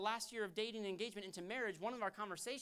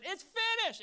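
loud, high proclamation; triumphant shouted declaration; final verdict; 11 semitones higher, 9 dB louder, slower than usual. Acted. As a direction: shout it as the gavel falls. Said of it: "When the gavel went down".

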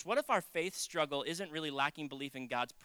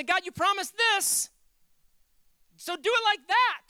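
loud, high, drawn-out demand; mock demand hurled at God; demanding prayer; 13 semitones higher, 9 dB louder, slower than usual. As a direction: insist hard, stretching the key words.

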